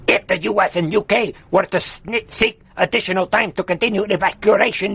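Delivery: mocking Afghan-accented voice quoting the spokesperson. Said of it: "I should do the right accent".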